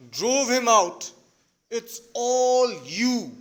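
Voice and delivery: loud, exasperated stage outburst; yelling. Acted. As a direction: raise your voice like a fed-up father, drawing out the accusations. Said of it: "or rather yells".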